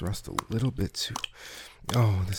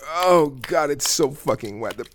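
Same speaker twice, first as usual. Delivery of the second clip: higher and louder, building to cries; climaxing; 9 semitones higher, 7 dB louder than usual. Acted. Lover reaching climax with his partner, rising cries. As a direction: let it climb into breathless climax cries.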